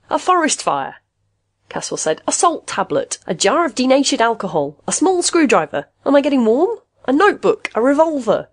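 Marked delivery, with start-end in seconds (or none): 0.1-1.0 exasperated. A narrator's reading. Exasperated patient guesses wildly. 2.3-8.5 high, mounting list of wild guesses; exasperated guessing. A narrator's reading. Exasperated patient guesses wildly.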